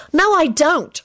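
put-on high, bitter girl's voice. A narrator's reading. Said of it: "Rosie told Henry bitterly".

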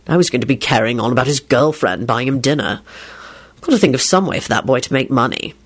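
low, grumbling groan. A narrator's reading. Lupin complaining about the boy's money, groaning. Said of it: "Lupin groaned".

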